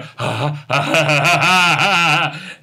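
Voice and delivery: forced staccato "ha ha ha"; imitated sniggering mates; mock group laughter. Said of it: "standing around going".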